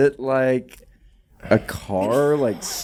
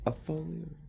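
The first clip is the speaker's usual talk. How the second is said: soft breathy whisper; 4 semitones higher, 16 dB quieter than usual. Real, not acted. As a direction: drop to a whisper. Echoed whisper.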